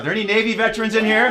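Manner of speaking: loud, fast, high rallying call; hype shout. Pretend. Host cues the audience to cheer for Navy veterans, hyped-up shout. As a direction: call it out big to whip up a cheer, rising at the end.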